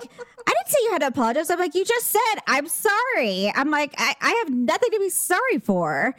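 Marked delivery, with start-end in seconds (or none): 0.0-1.5 mock defensive woman. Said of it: "And she's just like". 1.7-3.5 pitched much higher, a mild mock raised voice, stretching out sorry. Retelling the fight to a friend, an imitated raised voice. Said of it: "raising my voice". 4.0-6.1 raised voice. Retold or imitated. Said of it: "raising my voice".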